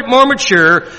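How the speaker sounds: ordinary delivery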